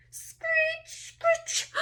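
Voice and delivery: high screeching imitation. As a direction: screech it like a scraping branch. Imitating scary noise.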